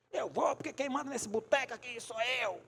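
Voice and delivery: cocky man digging his heels in; defiant retort. Retold or imitated. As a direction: insist you are the boss here. Acted defiant man in church.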